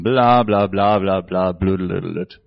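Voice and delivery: low, droning gibberish babble; mock babble. Preacher mocks the noise of all voices in low babble. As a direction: drone out meaningless noise.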